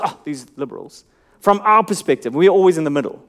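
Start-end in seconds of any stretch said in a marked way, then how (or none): 0.0-1.0 much softer and lower, a dismissive groan; scornful sigh. Retold or imitated. Mocking how we talk about conservatives, softly scornful. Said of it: "or the liberals".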